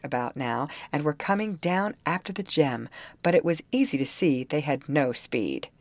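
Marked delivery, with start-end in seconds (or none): none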